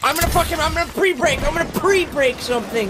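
high-pitched, fuming outburst; real gamer rage. Real, not acted. Gamer about to break something, real rage. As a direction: rant in exasperation, pitch up high. Raging after being knocked off.

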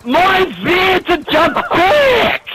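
high, drawn-out protest; frustrated yell. Real, not acted. Player protesting to teammates, frustrated yell. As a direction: wail it high in exasperation.

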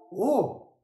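ordinary delivery

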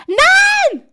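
very high, loud cry of no; outraged shout. Real, not acted. Streamer caught by a prank, shocked shout. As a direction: yell no in shock.

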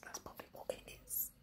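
whispered repeat. Shown as a demonstration. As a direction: whisper it to yourself.

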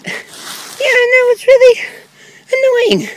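high character voice. Acted.

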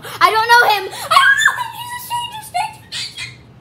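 high, loud squealing, building; mock panicked shout. Kid pretends Dad is a stranger, shrieking in mock panic.

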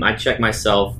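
normal talking voice

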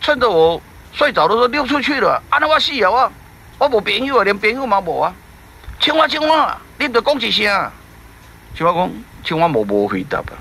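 high, whiny fish voice lamenting a lost friend; lightly voiced fretting fish; fretful fish. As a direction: wail it like a lonely fish.

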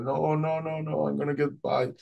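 brace in terror for the attack; retold dread. Expects a shark bite.

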